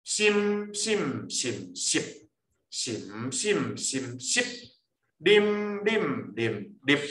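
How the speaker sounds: slow tone recitation, each syllable on its own pitch; drawn-out syllables stepping through the tones, ending short; eight-tone drill. Shown as a demonstration. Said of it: "第二八声调哦".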